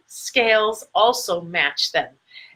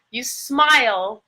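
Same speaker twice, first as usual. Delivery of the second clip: ordinary delivery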